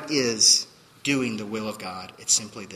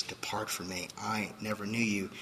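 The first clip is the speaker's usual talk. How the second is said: quiet verdict; 3 semitones lower, 9 dB quieter than usual. Acted. Jesus' verdict to the claimants.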